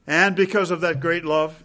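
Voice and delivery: usual speaking voice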